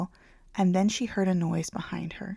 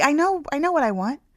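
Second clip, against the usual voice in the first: higher, louder, rushing to convince; insistent, flustered; 7 semitones higher, 6 dB louder than usual. A narrator's reading. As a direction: insist nervously that you know what you want. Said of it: "she said quickly".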